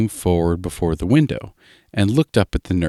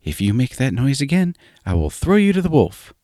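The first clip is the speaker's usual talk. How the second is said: high, threatening nurse; old nurse voice; 7 semitones higher than usual. A narrator's reading. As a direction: scold the child, pitched high. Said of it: "said an old nurse".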